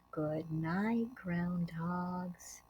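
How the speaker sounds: hushed, tender bedtime goodbye; soft goodnight. Acted. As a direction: tuck them in softly. Bedtime ending of story.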